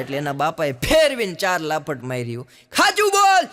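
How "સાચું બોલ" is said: high, loud furious yell; angry father. Retold or imitated. Father slaps son over ninety marks, furious high yell. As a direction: yell at the boy to tell the truth.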